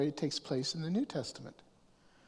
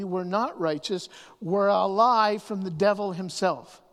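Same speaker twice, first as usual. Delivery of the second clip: normal talking voice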